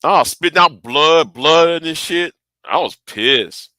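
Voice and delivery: ordinary talk, nothing marked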